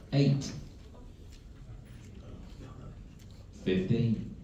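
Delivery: steady sing-song number calling; bingo caller. Acted. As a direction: call each number out to the hall.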